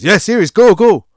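high, loud shooing yell; the dealer's loud mock order to leave; mock shout. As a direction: bark him out of the shop. Dealer shooing him out, retold.

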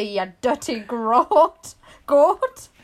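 drawn-out Scottish insult; Scottish accent. Acted. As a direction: scold in a put-on Scottish accent. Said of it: "had to say that in a Scottish accent".

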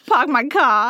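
put-on hammy Boston accent, no r. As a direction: drop the r like Boston. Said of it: "We're trying to emulate it".

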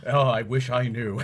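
slow mock lament; hammed-up, drawn-out quote; imitated hostage. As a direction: draw it out like a helpless captive. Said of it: "And he goes".

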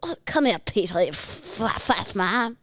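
Stewie impression. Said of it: "give me another one".

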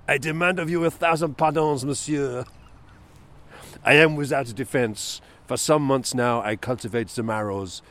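put-on a fussy Belgian detective's French accent; French accent Poirot. Reading Poirot's apology to the neighbour in a French accent. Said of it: "Monsieur Poirot's accent".

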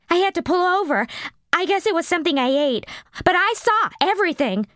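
put-on high girlish voice, quick in the middle; eager witness. The chunky girl telling the cops, high and eager. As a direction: tumble through it, eager.

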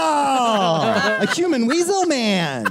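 high, slow, shouted weasel man. Acted. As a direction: squeal it out like a human weasel. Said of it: "Do like a weasel voice".

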